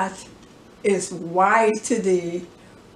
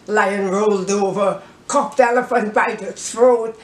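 usual speaking voice

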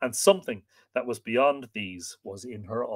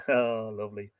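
ordinary delivery

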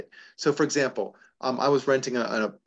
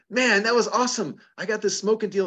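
higher-pitched, mildly thrilled caller; retold excited client; 8 semitones higher than usual. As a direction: imitate a delighted customer reporting back. Client phones with good news.

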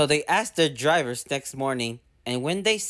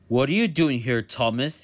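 lower, stern questioning; stern director; 3 semitones lower than usual. Acted. Fat director to Thomas, stern and lower. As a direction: question him like a strict boss. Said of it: "he asked sternly".

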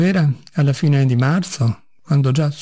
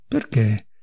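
soft, low-pitched, gentle asking; gentle question; 5 semitones lower, 3 dB quieter than usual. A narrator's reading. Doctor gently questioning the raging patient, soft. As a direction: ask it mildly and quietly. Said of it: "domandò dolcemente".

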